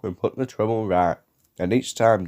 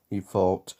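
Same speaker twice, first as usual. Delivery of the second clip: ordinary delivery, nothing marked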